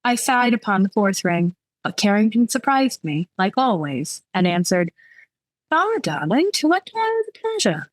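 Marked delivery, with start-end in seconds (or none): 5.7-7.9 high, lilting voice for a lover; suave love interest. Acted. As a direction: greet a darling with a playful lilt. Love interest answers the phone.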